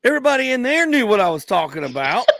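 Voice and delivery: high, loud protest; mock exasperation, voice pushed up to a question; defensive disbelief. Comedian defending his bit to wife, loudly incredulous.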